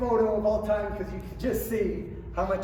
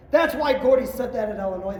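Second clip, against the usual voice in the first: ordinary talk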